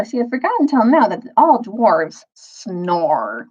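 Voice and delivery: plain reading voice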